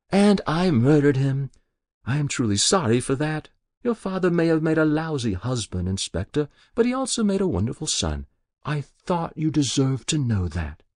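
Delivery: put-on a guilty old woman pleading with the inspector; Muriel's voice. Muriel apologises.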